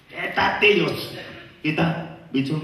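slow, far-off drawn friend voice; a lightly voiced pushy friend. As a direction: stretch it like a grabby friend.

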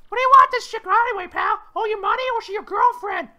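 very high, loud pestering cartoon voice; Toad voice. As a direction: quiz them in a piercing falsetto. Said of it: "finally do a Toad voice again".

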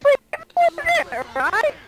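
very high, squeaky fake British; exaggerated high-pitched British voice; mock British accent. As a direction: push a silly British accent far up in pitch. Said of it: "you like the accent".

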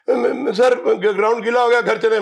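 stammering scared player. Retold or imitated. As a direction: mimic a nervous kid stammering to the coach. Scared players asking to go home. (घबराकर हकलाते हुए ऊँची आवाज़ में)

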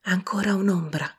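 ordinary delivery

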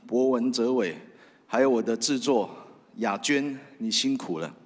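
ordinary talk, nothing marked

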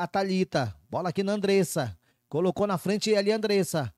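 normal talking voice

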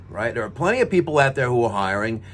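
ordinary talk, nothing marked

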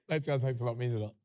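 low, quiet, flat thank-you. Real, not acted. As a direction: mumble thanks without feeling. It's the tone.

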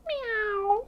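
very high, drawn-out kitty meow; cat meow. A narrator's reading. Storyteller recapping the dog's sound for children, high meow. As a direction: mew high and long like a kitten. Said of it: "And the dog said".

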